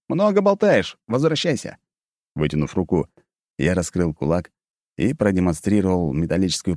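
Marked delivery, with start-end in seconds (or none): 0.1-1.8 curt, bored dismissal; dismissive command. Acted. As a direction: brush the demon off. Hero dismissing the spirit.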